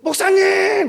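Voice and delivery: high, sustained shout; acted long-distance call to get attention. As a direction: hail someone from behind. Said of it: "뒤에서".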